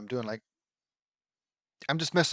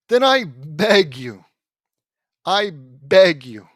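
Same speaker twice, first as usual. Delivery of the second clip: loud, emphatic exasperation; exasperated rant; 3 semitones higher, 7 dB louder than usual. Real, not acted. Reader ranting about nonsensical traps, loudly exasperated. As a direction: plead loudly in disbelief.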